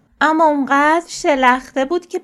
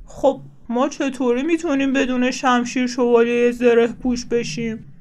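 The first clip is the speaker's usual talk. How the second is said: disappointed. A narrator's reading. Bear asks Franklin how they can play swordless, disappointed. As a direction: let the question sink at the end. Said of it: "خرسی با ناامیدی پرسید".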